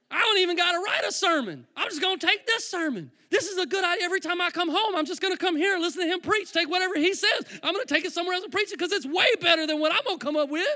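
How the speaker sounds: loud, high, hammed-up excitement; building, overexcited preacher's retelling; thrilled scheming. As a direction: exclaim it like you've struck gold. Acts out his thrilled scheming, retold.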